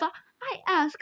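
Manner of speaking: ordinary delivery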